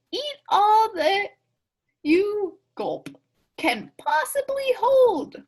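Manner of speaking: high, slow, loud mother bear urging her cub. A narrator's reading. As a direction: coax the cub to eat, drawn out and high. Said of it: "she said".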